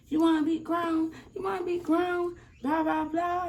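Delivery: high, mocking scold from the angry mother; imitating a furious mother, pitched high. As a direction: mimic mom snapping at her grown-acting daughter. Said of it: "got real mad at her".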